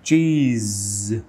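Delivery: চিজ drawn out very slowly so the final zh rings clearly; slow demo of চিজ. Shown as a demonstration. Teacher pointing learners to the zh sound at the end of চিজ. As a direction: stretch চিজ and let its final zh hum. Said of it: "আমরা একটু দেখি".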